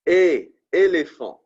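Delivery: éléphant said slowly in French, é like English A. Shown as a demonstration. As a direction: sound out éléphant carefully, é as in A. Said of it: "For example".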